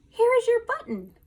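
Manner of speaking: high, loud excited call; cried out. A narrator's reading. Frog finds a button in the meadow, high excited cry. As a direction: Frog cries out in triumph. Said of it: "cried Frog".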